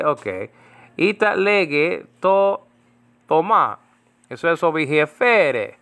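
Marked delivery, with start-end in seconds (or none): 1.0-3.8 Greek words read with an Italian lilt; Italian-accented Greek. Shown as a demonstration. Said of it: "I put an Italian accent to these things". 5.1-5.8 Italian lilt on the Greek "Fere"; Italian-accented Greek. Shown as a demonstration. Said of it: "I put an Italian accent to these things".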